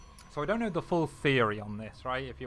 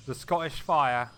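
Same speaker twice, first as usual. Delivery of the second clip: loud, drawn-out ring announcer call; 7 dB louder, slower than usual. Pretend. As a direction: boom it out like a title fight. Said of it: "I don't know why I'm calling him the Scottish Fire".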